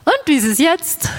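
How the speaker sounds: lightly acted audiologist, pitched high; imitated audiologist's high, lilting query; audiologist's test question. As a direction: ask in a high voice, checking the hearing aids.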